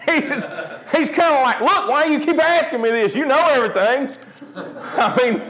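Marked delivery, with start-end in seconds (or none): 1.6-4.1 frustrated; comically exasperated, high-pitched complaint; Peter. Retold or imitated. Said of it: "he was frustrated".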